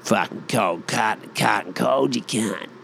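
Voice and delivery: thick Aussie larrikin voice; Aussie beer-order tongue-twister; broad Aussie order. Acted. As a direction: mash the words together in broad Aussie. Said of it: "the most Aussie fucking thing to order".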